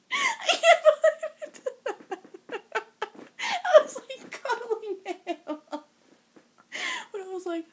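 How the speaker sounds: high squealing giggles through the words; can barely speak for laughing. Real, not acted. Fan laughing while telling the viewers about cuddling Cam.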